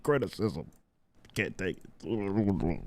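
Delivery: low, slow muttered mockery; low slow mocking mutter; quiet mock. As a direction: drop low and drag the mockery. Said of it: "mocking critics' word".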